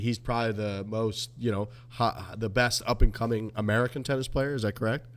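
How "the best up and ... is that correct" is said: ordinary talk, nothing marked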